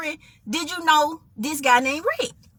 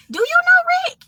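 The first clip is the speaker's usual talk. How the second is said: high-pitched, pointed query; high, probing retold question; suspicious question; 13 semitones higher, faster than usual. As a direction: imitate her asking, pitched way up. Tasha turns suspicious, questions her.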